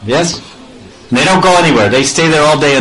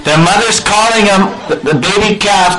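ordinary delivery

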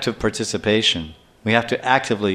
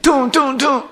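very high "toom" gunshot noises; imitated gunshots; 13 semitones higher than usual. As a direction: fire off high-pitched mock pistol shots. Imitating pistol shots in story.

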